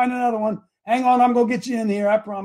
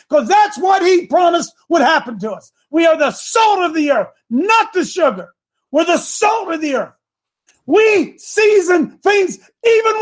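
high, loud, shouting sermon; fiery preacher in full flow; preaching at full force; 10 semitones higher, 4 dB louder than usual. Acted. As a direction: hammer it home to the congregation.

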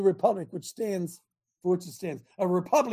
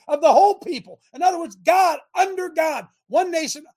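rising angry shout, higher and louder; getting angry; 7 semitones higher, 4 dB louder than usual. Real, not acted. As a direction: let the anger boil over. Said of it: "I'm sorry if I'm getting angry".

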